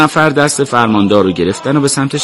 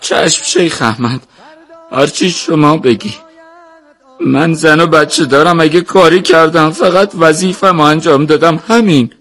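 high, submissive voice building louder and more desperate; frightened pleading; 7 semitones higher, 3 dB louder than usual. A narrator's reading. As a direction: defend yourself anxiously to the man judging you. Frightened governor pleads with the sheikh.

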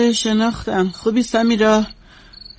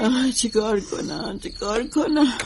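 despairing moan; 10 dB quieter than usual. Acted. Grieving woman in shock.

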